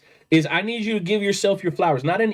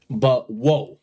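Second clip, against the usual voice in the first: ordinary talk, nothing marked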